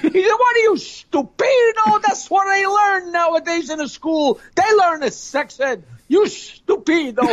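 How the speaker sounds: drawn-out, comic scolding; scolding Italian parent. Acted. Italian parent scolds child about school, exasperated.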